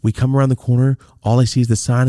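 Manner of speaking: normal talking voice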